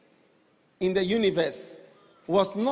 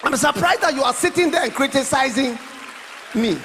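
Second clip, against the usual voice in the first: louder, building mock rebuke; mock indignation; 4 semitones higher, 6 dB louder than usual. Real, not acted. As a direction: press the point on the listener. Preacher mock-scolds critics.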